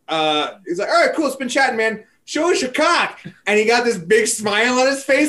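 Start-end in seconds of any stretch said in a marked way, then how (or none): 0.9-3.2 higher, casual imitation of a drunk buddy; retold buddy. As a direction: toss out the dare lightly. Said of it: "my buddy Tony was like".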